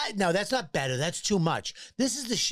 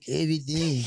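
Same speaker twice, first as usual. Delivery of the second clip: swollen tongue; 2 semitones lower than usual. Retold or imitated. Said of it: "Why is he going".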